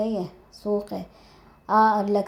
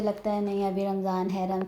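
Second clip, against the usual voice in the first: normal talking voice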